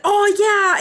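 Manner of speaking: high, loud, drawn-out mock delight; retold excitement. Streamer retelling a friend's reply, high and excited. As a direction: act out an eager agreement.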